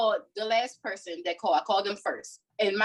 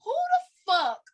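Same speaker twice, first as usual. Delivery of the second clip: outraged; 12 semitones higher, slower than usual. Real, not acted. Outrage at rumor about mother.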